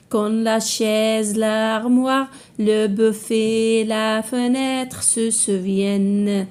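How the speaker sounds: slow, measured poem reading with sustained, level notes; poem recitation. Shown as a demonstration. Teacher reads the poem to pupils, slow measured recitation. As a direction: recite line by line, drawing out the words.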